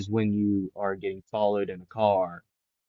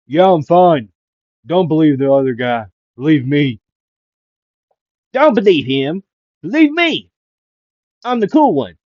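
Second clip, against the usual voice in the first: loud boasting Twice; 5 semitones higher, 10 dB louder than usual. Retold or imitated. Twice's other half interrupts.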